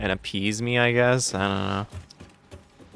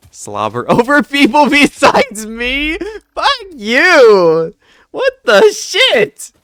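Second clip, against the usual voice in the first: mock outraged yelling; 12 dB louder, slower than usual. Streamer hamming up a shocked yell at her chat, loud and high. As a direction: shout it much higher and louder, half laughing.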